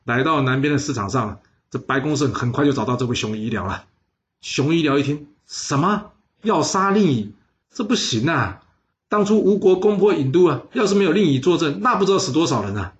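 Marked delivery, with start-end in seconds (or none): 5.4-12.9 higher, alarmed outcry of refusal; shocked protest. A narrator's reading. 熊医疗 learns they want to kill the premier, alarmed. 熊医疗 hears the assassination plan.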